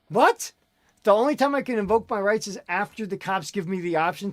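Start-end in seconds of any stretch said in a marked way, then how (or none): 0.1-0.5 incredulous what. Real, not acted. Disbelief at arrest for silence.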